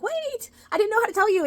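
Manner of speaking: high, breathless pleading quote; pleading Lane. Retold or imitated. Lane chasing Rory, pleading in a high voice.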